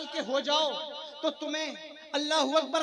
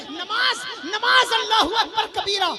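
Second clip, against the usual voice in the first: ringing shout; 4 semitones higher, 5 dB louder than usual. Real, not acted. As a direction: stretch each नमाज into a rally cry. Preacher crying out the word.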